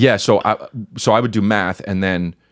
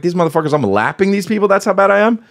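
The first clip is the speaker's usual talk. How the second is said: rising higher into retold outrage; mock disbelief; 9 semitones higher than usual. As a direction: play the kid who just realized how bad he is. Retelling his own disbelief in class.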